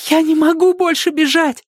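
very high monotone wail; high, strained storybook exhaustion; gasped cry. A narrator's reading. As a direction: cry out that you are spent. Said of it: "задыхаясь".

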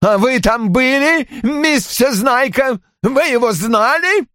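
high-pitched sneering challenge; angry taunt. A narrator's reading. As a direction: fling the questions at a know-it-all. Roy taunts his daughter angrily. (гневно, с издёвкой)

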